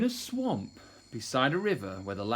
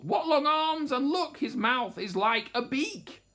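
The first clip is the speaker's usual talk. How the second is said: high, horrified motherly complaint; grumbling; 12 semitones higher, 3 dB louder than usual. A narrator's reading. Mother T criticising her baby's looks, high grumbling. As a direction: find fault in a high voice. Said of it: "and she grumbled".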